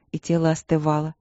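plain reading voice

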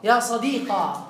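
"صديقة" said slowly with the final ya turned into a long aa; ya to alif, ya sadiqa. Shown as a demonstration. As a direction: draw out the aa ending of "صديقة". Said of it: "قلب الياء ألفا".

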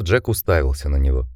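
plain reading voice

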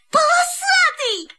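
gasp, then cry out high and slow; startled cry of joy; surprised gasp. Acted. Kitten gasps, surprised.

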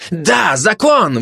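high-pitched, drawn-out outcry; desperate-sounding high exclamation; crying out. Acted. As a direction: cry out high and slow. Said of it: "выкрикнул предатель".

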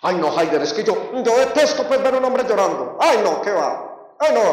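mock-indignant woman, rising in pitch; hammed-up exasperated complaint. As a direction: imitate someone appalled and disgusted. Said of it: "ven un hombre llorar y se indignan".

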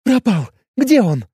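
high, urgent alarmed cry; blurted alarm. A narrator's reading. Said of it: "выпалила девушка".

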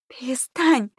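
high, urgent hissed order; sharp, high plea to stop; angry hiss. A narrator's reading. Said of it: "зашипела Настя".

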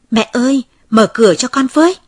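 calling loudly through the shut door. Acted. Child calling mother to open the door, loud plea. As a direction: raise the voice to reach mother inside.

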